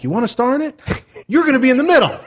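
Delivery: high, eager director sales pitch; Tom Six. Acted. Director casting the middle part, high eager pitch. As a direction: offer it like a dream role. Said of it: "now imagine Tom Six, that's the director".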